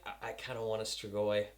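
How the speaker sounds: low, soft mock-shy admission; sheepish confession. Quotes own sheepish jealous wish.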